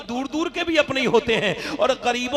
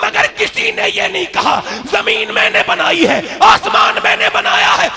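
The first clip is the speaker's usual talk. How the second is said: higher and louder, shouting the sermon; fiery preaching; 8 semitones higher, 10 dB louder than usual. Acted. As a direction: thunder it out to the crowd. Preaching at full force.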